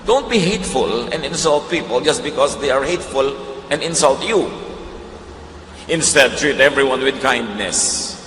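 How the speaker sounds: slow, measured Bible reading; preacher reading a Bible verse slowly to the congregation; scripture reading. Acted. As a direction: read the verse with weight. Reads First Peter aloud.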